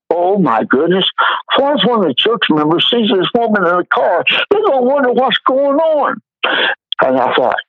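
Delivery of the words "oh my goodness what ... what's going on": panicked. Retold or imitated. Retold panicked thought.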